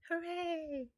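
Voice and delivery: high, bright kitten cheer. A narrator's reading. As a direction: cheer out joyfully. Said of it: "cheered Marie".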